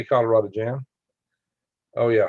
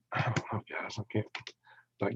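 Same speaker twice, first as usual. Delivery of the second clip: whisper; 13 dB quieter than usual. Real, not acted. Lights off for the squatch story.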